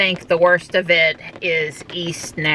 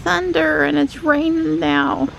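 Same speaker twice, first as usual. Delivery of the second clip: pet talk; 12 semitones higher than usual. Real, not acted. Talking to cat.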